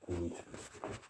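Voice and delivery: a hushed unvoiced echo; breathy whisper answering himself. Shown as a demonstration. Repeated line, voiced then whispered.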